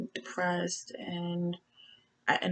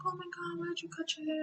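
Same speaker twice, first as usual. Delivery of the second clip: much higher, softer imitated gasping question; mock astonishment; 12 semitones higher, 7 dB quieter, faster than usual. Friends react to her shaved-off hair, mock astonished. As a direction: imitate everyone's surprise in a high voice.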